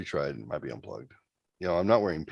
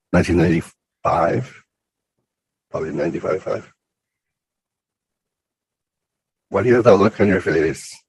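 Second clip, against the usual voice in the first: loud, warped filtered voice; voice changer effect; 12 dB louder than usual. Real, not acted. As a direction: speak through a strange voice filter. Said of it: "you sound really strange".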